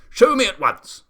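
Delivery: a commanding shout at the giraffe. A narrator's reading. The Duke demanding to be shown the room, shouting.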